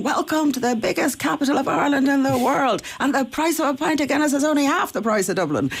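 high, hammed-up speech to twenty thousand Irish; imitating Boris's speech. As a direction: send up Boris's speech to the crowd. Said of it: "out comes Boris saying".

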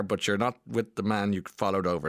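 ordinary delivery

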